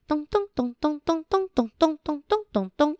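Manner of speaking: high, soft chanted footsteps; footstep sounds. Acted. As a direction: tap out little footsteps. Footsteps sound in picture book.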